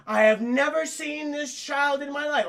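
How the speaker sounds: hammed-up, high and slow wooden denial; stilted mock denial; bad acting. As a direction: overplay it like a terrible actor. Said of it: "acting is so bad".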